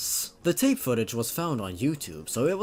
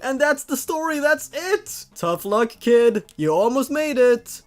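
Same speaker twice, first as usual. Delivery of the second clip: excited; 10 semitones higher, 5 dB louder than usual. Real, not acted. Laughing reaction after ending.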